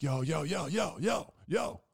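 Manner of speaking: loud, rising mock yell; imitated calling out. Friend calling out to him.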